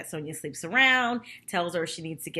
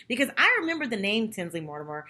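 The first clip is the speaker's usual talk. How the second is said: normal talking voice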